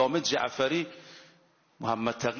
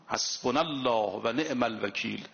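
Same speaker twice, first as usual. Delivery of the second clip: Quranic recitation; 5 semitones lower than usual. Acted. Quoting the Quran again.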